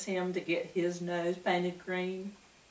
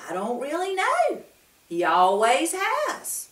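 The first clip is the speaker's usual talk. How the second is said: puzzled wemmick voice; 9 semitones higher, 3 dB louder than usual. A narrator's reading. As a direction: voice the tall wemmick, unsure.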